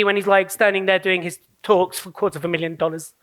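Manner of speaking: ordinary delivery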